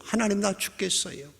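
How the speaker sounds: high-pitched lament to God; complaining plea. Acted. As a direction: plead why, swelling then dropping off. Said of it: "하소연하고 투덜거리기 시작하고". (높은 목소리로 원망 섞어 하소연하듯)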